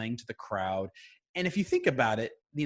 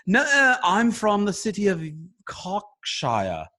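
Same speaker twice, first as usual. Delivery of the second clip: bad British accent, higher and louder, slow on totally British; 7 semitones higher, 8 dB louder, slower than usual. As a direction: insist you're British in a terrible British accent. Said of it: "do a really horrible British accent".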